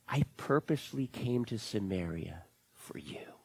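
low, whispered words of Jesus; Jesus whispering softly to the woman; tender whisper. Retold or imitated. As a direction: whisper it gently to her alone. Jesus voiced speaking to woman.

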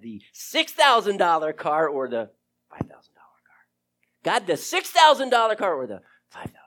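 urgent hushed whisper to God; breathless whispered pleading; whispered prayer. Retold or imitated. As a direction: whisper the choice as if begging God.

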